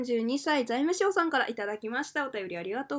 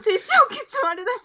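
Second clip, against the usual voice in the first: laughing; 10 semitones higher, 7 dB louder than usual. Real, not acted. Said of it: "一回落ち着いて読もうか".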